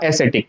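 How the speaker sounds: एस्थेटिक said "e-SE-tik", with an s and no k; correct soft C pronunciation. Shown as a demonstration. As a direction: say एस्थेटिक with a soft s sound in the middle. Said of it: "इसका correct pronunciation होगा". (एस्थेटिक को "ए-से-टिक" बोलें)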